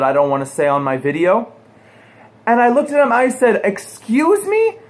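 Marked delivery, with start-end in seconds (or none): 3.6-4.8 high, slow, stretched out disbelieving excuse me; sky-high mock-offended excuse me; incredulous. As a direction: drag it up high in outraged disbelief. Incredulous reply to a threatening stranger.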